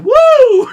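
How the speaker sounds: very loud, high "woo"; piercing hyper holler; caffeinated whoop. Shown as a demonstration. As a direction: burst out like you're wired on caffeine. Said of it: "a bunch of caffeine today".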